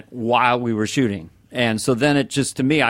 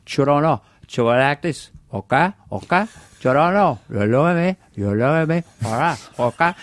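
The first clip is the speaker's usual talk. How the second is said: put-on Scarface Cuban accent, swaggering; Al Pacino Cuban accent; 2 semitones higher than usual. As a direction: tough Tony Montana Cuban accent. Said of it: "Al Pacino did with the Cuban accent".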